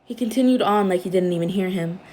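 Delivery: ordinary delivery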